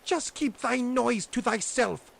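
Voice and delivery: high, loud witch scolding. A narrator's reading. Witch silencing Grethel, high loud scolding voice. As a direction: snap at the girl like a witch. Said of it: "said the old woman".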